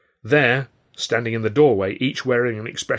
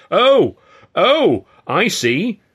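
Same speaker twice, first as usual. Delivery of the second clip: loud, high indignant exclamations; high-pitched angry outburst; furious shout; 10 semitones higher, 10 dB louder, much slower than usual. A narrator's reading. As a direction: shout each 'oh' in outrage. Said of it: "Ed shouted, his face turning puce".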